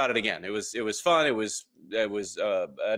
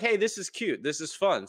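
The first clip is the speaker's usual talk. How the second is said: pleased discovery; 8 semitones higher than usual. Retold or imitated. Retelling his own pleased reaction.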